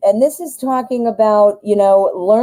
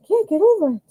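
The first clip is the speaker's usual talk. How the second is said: much higher, playful squeaky repeat; silly high echo; 11 semitones higher, faster than usual. Pretend. To viewers, echoing her own delight, playfully high. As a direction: echo yourself in a goofy high voice.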